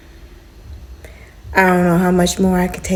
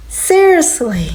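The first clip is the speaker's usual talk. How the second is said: slower, falling, drawn-out seriously; weary disbelief; 6 semitones higher, slower than usual. Real, not acted. Tenant giving up on the landlord, slow weary disbelief. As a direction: let it sag in resignation. Exasperated at broken smoke detector.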